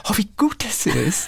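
high-pitched mock admiration; imitating co-host. Teasing the co-host over how they say it, high mimicking voice. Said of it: "wie du sagst".

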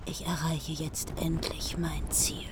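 breathless whispered triumph; triumphant whisper. Acted. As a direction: whisper it to yourself, gloating. Kriemhild alone, triumph hushed.

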